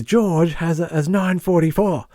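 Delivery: retold exasperated shout. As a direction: imitate a doctor who has had enough. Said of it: "has enough of this and he just shouts".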